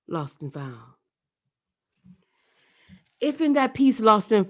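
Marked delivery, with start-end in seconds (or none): 0.1-0.9 very soft, low closing title; soft final words of the poem; hushed title. Acted. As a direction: breathe the title out.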